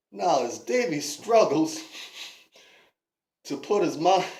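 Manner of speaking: usual speaking voice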